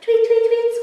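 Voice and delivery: high, loud birdy chirps; bird tweeting. A narrator's reading. Bird tweets at Theodore in his cave, high chirping. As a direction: chirp it out like a noisy little bird. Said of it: "It tweeted and squawked at Theodore".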